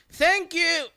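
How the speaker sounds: high chirpy greeting; bright receptionist voice; answering the phone again. Retold or imitated. Greeting cut off.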